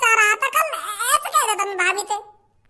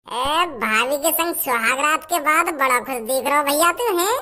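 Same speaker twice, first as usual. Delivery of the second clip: sly teasing; 10 semitones lower than usual. Acted. Teasing brother after wedding night.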